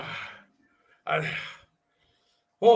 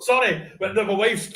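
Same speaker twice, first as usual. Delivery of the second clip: ordinary delivery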